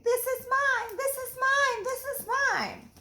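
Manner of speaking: high, bickering frog voices; petulant high-pitched squabble; squabbling frogs. Acted. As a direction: whine it like a greedy little frog. Frogs always arguing.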